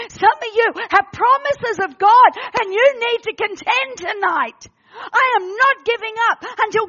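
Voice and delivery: loud, defiant declaration of faith; impassioned preaching. Real, not acted. Preacher contending with God for His promises, impassioned. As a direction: hammer it out, stretching the final words.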